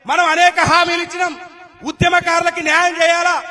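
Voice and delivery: loud, forceful rally speech; indignant political oratory. Acted. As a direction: hammer out each grievance to the crowd. (ఆగ్రహంతో గట్టిగా రాజకీయ ప్రసంగం)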